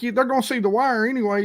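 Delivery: quick, high mock reply; imitating arguing anglers. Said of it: "They're like".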